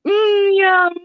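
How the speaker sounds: high hammed-up yum; a squeaky mock savouring. [name] tasting the scallops, squeaky mock yum.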